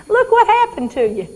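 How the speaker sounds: high, laughing through the words. Acted. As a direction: laugh while acting the mother. Laughing while acting mother.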